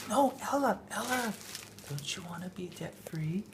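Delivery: put-on soft, breathy child whisper fading out; imitated whisper. Dad imitating son whispering debt-free plea to sister. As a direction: whisper it like a coaxing brother. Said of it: "whisper to Ella".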